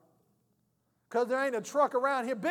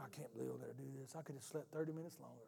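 softer, fast, resentful muttering; grumbling under breath; 7 semitones lower, 15 dB quieter, faster than usual. Retold or imitated. Reluctant greeter at the door grumbling to himself. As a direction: rattle off a quiet sulky complaint. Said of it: "you're like".

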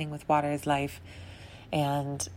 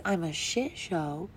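inner critic voice; 6 dB quieter than usual. Retold or imitated. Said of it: "my head says".

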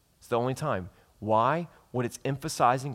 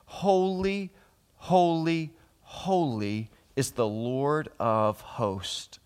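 high, drawn-out call settling to usual pitch; angels calling out; 4 semitones higher, much slower than usual. Acted. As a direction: call each "holy" slowly and solemnly. Angels' call quoted from Scripture.

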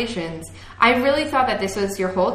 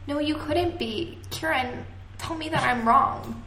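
higher, hushed line reading; soft Emma impression; 6 semitones higher, 6 dB quieter than usual. Shown as a demonstration. Friend doing an impression on request, soft and higher. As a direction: play the heroine softly, pitched up. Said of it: "do it in your impression".

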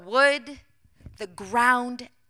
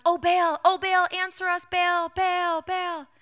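high, drawn-out chanting cry; imitated shouting; 8 semitones higher, 4 dB louder, slower than usual. Prophets of Baal begging their god to answer, shouting. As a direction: call out to a god, pitched up and insistent.

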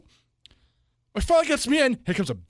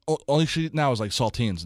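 usual speaking voice

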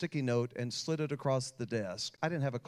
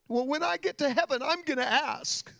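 put-on high, louder girl's voice; young girl's voice; 13 semitones higher, 5 dB louder than usual. Preacher voicing the twelve-year-old girl, high and hopeful. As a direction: lift into a child's hopeful resolve.

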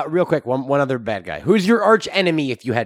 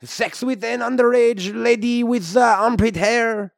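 put-on comic French-Canadian accent; hammy Québécois hero voice. French-Canadian Wolverine character.

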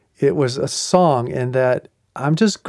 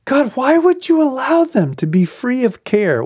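much higher, pleading complaint to God; anguished plea; 11 semitones higher than usual. Retold or imitated. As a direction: cry out why to God.

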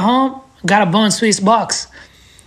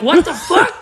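much higher, incredulous curse; shocked friend; 10 semitones higher than usual. Retold or imitated. Vern hearing how many bearings, high shocked curse. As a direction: gasp it out in disbelief. Vern hears twenty sets.

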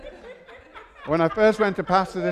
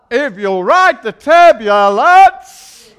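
louder, slower, drawn out; slow emphatic preaching; 5 semitones higher, 12 dB louder, much slower than usual. Acted. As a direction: lean in and build. Building sermon repetition.

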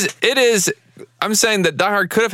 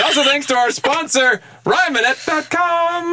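announcer voice; 9 semitones higher, slower than usual. Pretend. Mock sponsor plug.

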